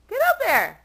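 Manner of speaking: high, loud exasperated shout. Real, not acted. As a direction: yell the cat up the cabinet. Coaxing cat onto cabinet.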